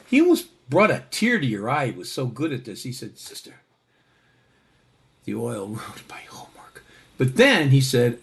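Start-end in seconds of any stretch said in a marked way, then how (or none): 3.2-6.8 sorrowful whisper. Retold or imitated. Johnny, head hung, confesses to the nun in a whisper. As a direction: breathe it out as a mournful whisper. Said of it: "he hung his head down".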